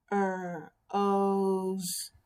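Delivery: "Er, o, z" as rose split into held r, long o, z; "Er, o, z" tapped out phonetically, sound by sound. Shown as a demonstration. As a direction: stretch and separate each sound of "Er, o, z". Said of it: "you can tap out the word".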